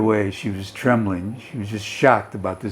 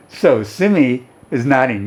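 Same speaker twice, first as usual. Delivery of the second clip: normal talking voice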